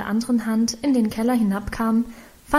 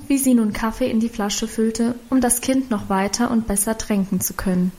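usual speaking voice

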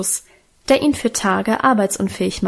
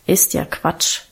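low, breathy, half-voiced grumble; grumbling dismissal; 4 semitones lower, slower than usual. A narrator's reading. Alwine dismisses her sister in bed, low grumble. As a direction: brush it off under the breath, lower. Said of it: "brummte sie".

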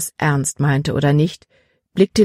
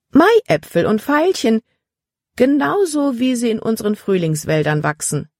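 high, gushing admiration settling gradually; delighted exclamation; 6 semitones higher than usual. A narrator's reading. Frau Weaver admires the drawings of spring flowers, exclaiming. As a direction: gush in a high voice. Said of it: "rief sie aus".

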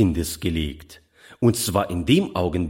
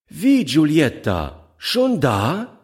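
surprised mother figure, voice raised higher; calling to the child; 7 semitones higher, 4 dB louder, slower than usual. A narrator's reading. Foster mother calls out to Giulietta, higher and surprised. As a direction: greet the child with glad surprise. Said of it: "rief sie dem Kind zu".

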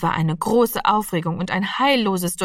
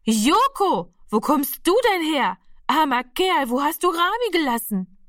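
higher, amazed calling out to the cat; loud surprised call; 8 semitones higher than usual. A narrator's reading. Mother finding the lost cat at the door, calling out loud. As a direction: cry out in delight at the returned cat. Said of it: "rief sie so laut".

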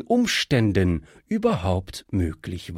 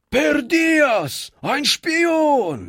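outraged uproar; 11 semitones higher, slower than usual. A narrator's reading. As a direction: jump up and accuse the stranger. The gang jumps up in uproar. (die Banditen springen auf und rufen hoch und laut ihre Anklage)

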